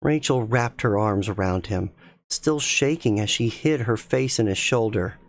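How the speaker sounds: plain reading voice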